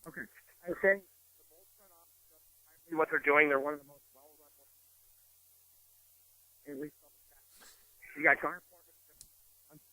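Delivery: fading in and out on a phone; bad phone line, voice dropping away; crackly cordless phone. Real, not acted. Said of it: "Are you holding the phone different? 'Cause you're fading in, in and out there".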